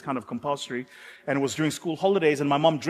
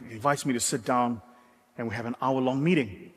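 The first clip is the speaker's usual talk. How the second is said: ordinary talk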